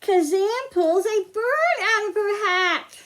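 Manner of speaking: put-on slow, lilting storybook read for kids; reading to children. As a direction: read it like story time for little ones. Reads picture book to children.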